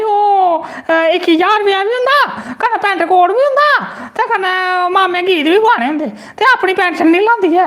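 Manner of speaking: put-on high, thin old granny voice; old woman voice. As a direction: play the grumbling old woman. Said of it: "बुड्ढी आखा दी".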